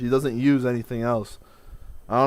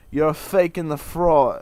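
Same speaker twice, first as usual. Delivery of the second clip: slow, louder Italian American mock accent, laughing; Livia Soprano voice; 5 semitones higher, 7 dB louder, slower than usual. Mocking his half-sister in an Italian American accent. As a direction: sneer it like an old Italian American mother. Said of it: "I said it like, uh, Olivia Soprano".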